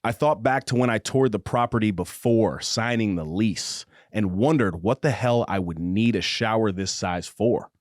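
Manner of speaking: slow, low romance narration; audiobook narration. Acted. Host reading a spicy romance passage aloud, measured narration. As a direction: read it like a slow, intimate audiobook.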